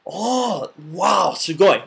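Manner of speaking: high, wide-eyed wow; amazed praise. Shown as a demonstration. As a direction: gush over their effort. Demoing praise in Japanese.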